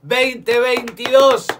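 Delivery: loud, high, stretched match opening; excited kickoff call. Real, not acted. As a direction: shout the kickoff like a stadium caller. Kickoff called live. (grito de arranque del partido)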